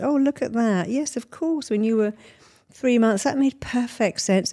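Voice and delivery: therapist's voice. Retold or imitated. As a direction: speak up as the therapist who finally sees it. Therapy speaking to the client.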